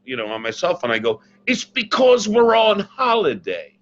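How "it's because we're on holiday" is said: high, slow, hammed-up English accent. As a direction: sell the excuse in a fake English accent. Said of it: "I called with an English accent".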